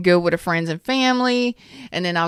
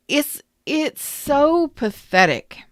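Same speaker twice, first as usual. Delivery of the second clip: slow, higher, dragged-out contempt; slow scornful drawl, pitched up; disgusted; 7 semitones higher, slower than usual. Real, not acted. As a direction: stretch it out in disbelief. Disgusted at the ex's behavior.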